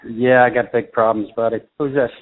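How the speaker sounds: ordinary delivery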